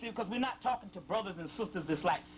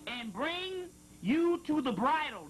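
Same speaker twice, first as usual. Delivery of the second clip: higher, slow, solemn intoning; scripture reading; 4 semitones higher, slower than usual. Acted. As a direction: draw out each word like prophecy. Reading a sacred passage aloud.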